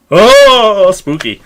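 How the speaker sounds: high, drawn-out ghostly oh; mock spooky. Joking aside about where they are from, mock spooky. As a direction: playfully wail like a pretend ghost.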